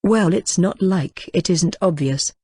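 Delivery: usual speaking voice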